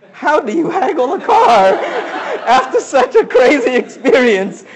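high, loud, laughing question; laughing disbelief. Retold or imitated. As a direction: laugh at how absurd it feels. Laughing disbelief about haggling.

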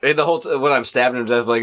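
normal talking voice

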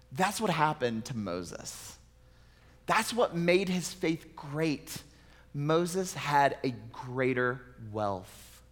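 ordinary talk, nothing marked

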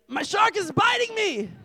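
high mock child's shriek; alarmed toddler. Son telling dad about his shark, high mock alarm. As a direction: imitate a small boy crying out, very high. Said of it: "He's like".

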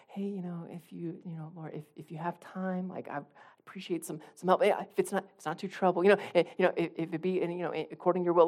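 soft, hesitant, stumbling request; timid prayer. Acted. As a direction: imitate someone meekly asking, barely daring to bother. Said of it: "we do it in this timid approach".

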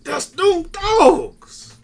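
loud, high, drawn-out cheer; geeked fan yell. Real, not acted. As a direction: holler proudly for your team, stretching the words. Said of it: "Man, I was geeked".

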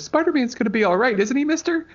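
put-on high, loud little boy asking hopefully; pleading kid. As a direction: play a worried child begging for reassurance. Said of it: "this kid looks at me and he goes".

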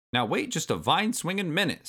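high, crabby granddad voice; feisty old ape putting his foot down; Cranky Kong. A narrator's reading. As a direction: object like an indignant old-timer.

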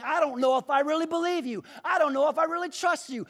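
high, loud, fast protest of doubt; complaining. Acted. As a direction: blurt distrust at God, fast and loud. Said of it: "he's complaining".

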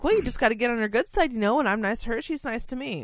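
high, fast, sing-song smugness; sugary high know-it-all. Retold or imitated. Narrator telling her boss, high, quick, smug. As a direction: rattle it off sweetly superior. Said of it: "I basically was like".

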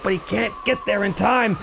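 normal talking voice

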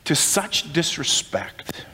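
a breathy whisper, slow; a slow solemn whisper. Acted. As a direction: drop to a hushed, slow whisper. Solemn turn after quoted complaint.

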